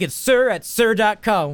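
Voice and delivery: slow, high mock employee; Domino's staff. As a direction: spell it out like obstinate customer service. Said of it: "They're like".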